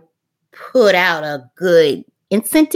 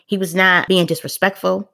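ordinary delivery

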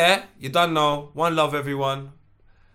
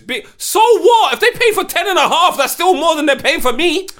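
high, loud outraged laughing rant; 10 dB louder than usual. Real, not acted. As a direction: yell it in mock outrage. Mocking the houses argument.

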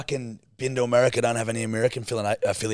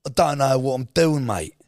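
louder, higher put-on London lad accent; London accent demo; 4 semitones higher, 4 dB louder than usual. Shown as a demonstration. Co-host coaching her London accent, exaggerated demo. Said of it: "No, just go, go like".

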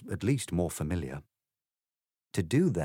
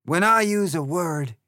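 loud, higher, scornful egg; 9 semitones higher, 7 dB louder than usual. Acted. The egg lectures Alice scornfully, loud and high. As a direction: say it with lofty contempt. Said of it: "in rather a scornful tone".

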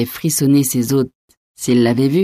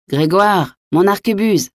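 higher-pitched, unalarmed call to a servant; casual order; 6 semitones higher than usual. A narrator's reading. Innkeeper to his valet, as if asking for chairs, casually. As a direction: call for it like any household item. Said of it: "du même ton qu'il eût dit".

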